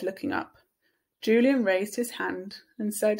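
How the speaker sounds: usual speaking voice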